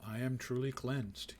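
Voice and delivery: slow, measured proclamation; mock declaration. Player announces being cleansed to viewers, mock declaration.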